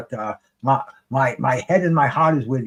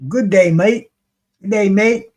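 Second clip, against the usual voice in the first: put-on higher, louder go at an Aussie accent; attempted Australian accent; 5 semitones higher, 5 dB louder than usual. Commentator greeting the Brisbane fighter in an attempted Australian accent. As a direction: have a rough stab at an Australian greeting. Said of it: "that accent down".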